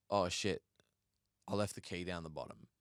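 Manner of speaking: sheepish admission. Retold or imitated. Partner admits forgetting key.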